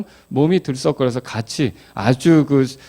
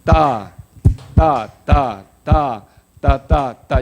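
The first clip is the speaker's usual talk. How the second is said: clapping syllables; much slower than usual. Shown as a demonstration. Teacher chanting a clap rhythm for the class. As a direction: call out ta ta on the beat. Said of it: "시작".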